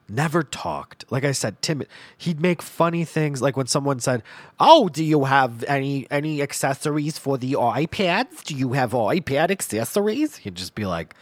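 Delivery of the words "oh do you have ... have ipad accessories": lightly put-on customer voice, a bit higher; higher, louder opener, mock customer asking; store customer. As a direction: imitate an eager customer's query. Imitating a store customer's question.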